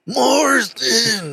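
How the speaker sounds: high, drawn-out wrestler holler; throat-straining imitation of a wild wrestler; hammed-up high mock scream; Randy Savage. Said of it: "did that hurt my throat".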